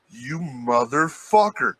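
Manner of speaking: slow, drawn-out mock cursing; retold slow-burn outrage. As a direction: stretch the curse out in disbelieving anger. Retold reaction to bleeding leg.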